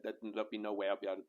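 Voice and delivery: ordinary delivery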